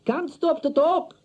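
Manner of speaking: high, loud, slow hurried call; wife calling from the door; wife shouting back. A narrator's reading. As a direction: call back while leaving. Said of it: "she shouted back".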